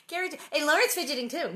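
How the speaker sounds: lightly mocked whiny sister; mock tattling. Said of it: "She's still going".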